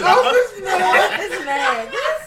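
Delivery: laughing hard with a high cry; words squeezed out through big laughter. Real, not acted. Cracking up at fan mail.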